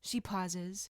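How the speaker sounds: ordinary delivery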